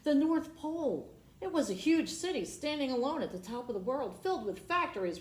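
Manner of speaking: high, loud and quick; awed arrival. A narrator's reading. Reader revealing North Pole to kids, excited wonder. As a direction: build wonder at the sight.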